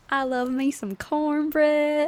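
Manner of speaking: playful southern twang, laughing; southern accent. Retold or imitated. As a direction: ham up a southern twang, slowly. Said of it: "southern belle".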